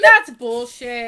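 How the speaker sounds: slow, loud indignant protest. Real, not acted. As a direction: stretch it out, loud and outraged. Green candy gone.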